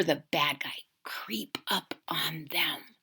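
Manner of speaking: whispered, sneaky narration; storyteller's creepy hush. A narrator's reading. Narrating sneaky villain approach.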